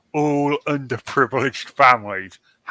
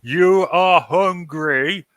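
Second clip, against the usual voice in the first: slow mock tourist speech; 3 semitones higher, slower than usual. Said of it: "Did you do it in a really loud voice".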